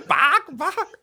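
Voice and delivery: high squawking chicken sounds. Shown as a demonstration. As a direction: cluck it out like a panicked bird. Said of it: "doing your chicken there".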